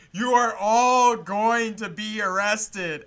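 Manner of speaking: slow, loud, deliberate announcement; Dave's grave warning. Retold or imitated. Dave stops the party to warn the crowd, slow and loud. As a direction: spell out each word like a stern final warning. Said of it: "takes a moment to stop and goes".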